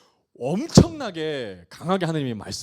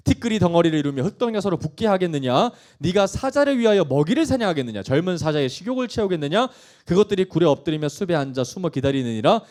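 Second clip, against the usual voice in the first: loud, driving declamation; forceful scripture reading; 6 dB louder than usual. Acted. God questioning Job about wild creatures, forceful. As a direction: fire off each question as a challenge. Reads God's questions aloud.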